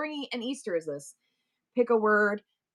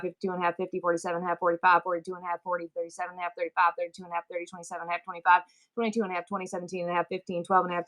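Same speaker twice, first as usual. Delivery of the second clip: rapid, even-pitched number chant; auctioneer countdown; 3 semitones lower, faster than usual. Shown as a demonstration. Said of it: "learn how to talk like that".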